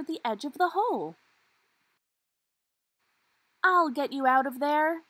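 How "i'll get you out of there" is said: loud and high rescue call; a brave puppy yelling down to the trapped Greta; shouted rescue. Acted. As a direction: shout down into the hole. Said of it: "he shouted".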